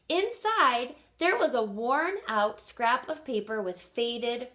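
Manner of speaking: expressive read-aloud for kindergartners; story reading. Acted. Teacher reading Enemy Pie to her class, lively storytelling. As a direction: read it like story time on the rug. Reads picture book aloud.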